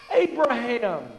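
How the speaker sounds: ordinary talk, nothing marked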